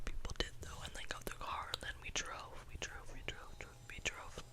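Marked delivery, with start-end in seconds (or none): none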